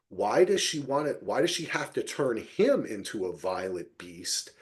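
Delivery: usual speaking voice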